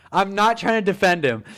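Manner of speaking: normal talking voice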